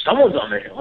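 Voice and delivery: insisting. Retold or imitated. Matt insisting to the crew.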